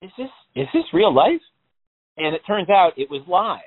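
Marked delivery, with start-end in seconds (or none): 0.0-1.4 high, astonished disbelief; incredulous. Retold or imitated. Telling his friend how stunned he was, high incredulous squeak.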